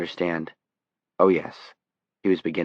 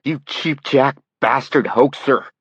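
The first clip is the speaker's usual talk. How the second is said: higher, louder, slow enraged yell; furious scream; 8 semitones higher, 5 dB louder, slower than usual. Acted. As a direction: scream the insult in rage. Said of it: "Dussault screamed at him".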